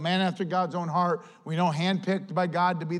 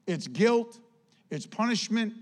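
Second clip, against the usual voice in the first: normal talking voice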